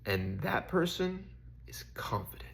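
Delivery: hushed whisper of a secret truth; a quiet, hushed whisper. Retold or imitated. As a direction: whisper it like a revelation. God's words, hushed.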